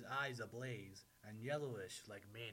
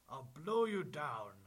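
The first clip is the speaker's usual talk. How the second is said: loud, raised big-bad-wolf threat; wolf threatening; 4 semitones higher, 10 dB louder than usual. A narrator's reading. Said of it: "the wolf replied".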